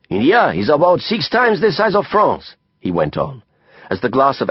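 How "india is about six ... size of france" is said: put-on higher French-accented voice, lecturing with passion; Didier, emphatic French friend. A narrator's reading. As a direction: expound like a theatrical Frenchman.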